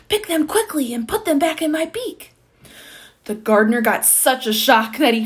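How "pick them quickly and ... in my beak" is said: usual speaking voice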